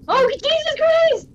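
startled cry. Real, not acted. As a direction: jump at the scare and cry out. Startled exclamation in game.

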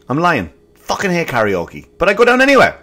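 ordinary talk, nothing marked

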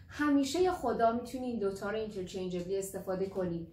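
normal talking voice

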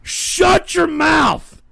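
high, drawn-out angry yell; enraged, high-pitched yelling; furious shout. Real, not acted. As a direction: scream to silence the trolls. Host silences trolls.

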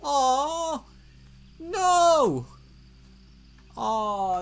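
high, loud mock wail of dismay; hammed-up grief. Player finds the family pet's grave, high hammed-up wail. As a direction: overplay the heartbreak.

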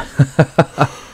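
high burst of relieved laughter. Real, not acted. Relief after just surviving.

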